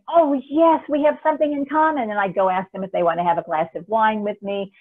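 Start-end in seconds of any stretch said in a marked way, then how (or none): 0.1-2.1 high, loud happy exclaim; light up at finding fellow English speakers; delighted recognition. Retold or imitated. To English speakers abroad, an excited glad hello. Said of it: "and I'm going".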